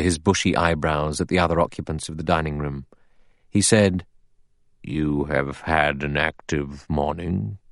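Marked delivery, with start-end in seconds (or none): 4.8-7.6 slow, measured relish with a touch of malice; faint malicious pleasure. A narrator's reading. Judge greets the searchers, with faint malicious pleasure. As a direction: draw it out, quietly enjoying the dig. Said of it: "a faint, malicious pleasure in his voice".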